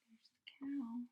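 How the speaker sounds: soft whispered aside; hushed pointing whisper. Real, not acted. Parent points at picture softly.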